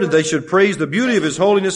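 normal talking voice

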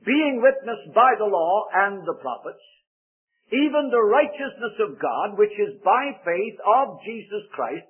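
slow, emphatic pulpit reading; scripture reading. Acted. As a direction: proclaim the verse phrase by phrase.

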